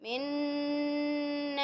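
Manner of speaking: इन्ना with the न्न held through the nose; nasal ghunna. Shown as a demonstration. Teacher demonstrating ghunna to students, nasal hum. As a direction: hum the doubled noon. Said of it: "नाक से हम निकालते हैं".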